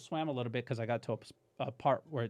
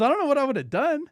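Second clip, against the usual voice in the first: wife's voice; 13 semitones higher, 10 dB louder than usual. Retold or imitated. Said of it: "She goes".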